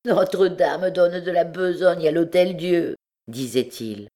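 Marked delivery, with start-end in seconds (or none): none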